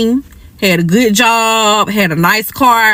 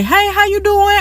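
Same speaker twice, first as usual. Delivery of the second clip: fake-sweet greeting; 10 semitones higher than usual. Greeting the other woman.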